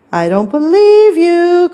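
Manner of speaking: high, loud sing-out reply; calling out. A narrator's reading. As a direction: shout back from afar. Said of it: "called out Tabby".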